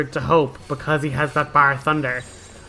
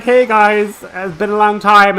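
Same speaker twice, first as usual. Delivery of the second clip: voicing a cheery friend, a bit higher and louder; cheerful greeting; 5 semitones higher, 7 dB louder than usual. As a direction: greet them brightly as Sazh. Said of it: "He's like".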